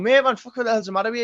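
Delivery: acting out a baffled, heated reply; retold exasperated challenge. Retelling his baffled reply.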